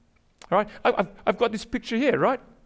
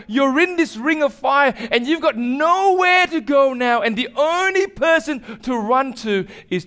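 higher, louder urgent preaching; preaching appeal; 6 semitones higher, 5 dB louder than usual. Acted. Preacher pressing the congregation about their ring of fire, urgent preaching.

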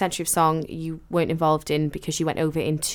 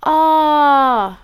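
surprised gasp; 8 semitones higher, 7 dB louder than usual. Real, not acted. Sudden recall of the song.

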